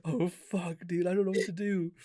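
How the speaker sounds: high, helpless mock panic; mock distressed. Dreamer finding friend's leg cut off, mock distress. Retold dismay at friend's injury.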